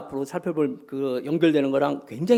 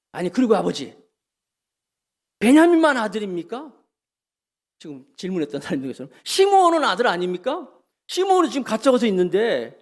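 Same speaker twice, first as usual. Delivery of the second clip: sons talking back to father, pitch raised and louder; defiant sons; 6 semitones higher, 4 dB louder than usual. Retold or imitated. Sons confronting father Jacob, raised heated protest. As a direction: push back hard at father. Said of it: "라고 막 대들었는지 몰라요".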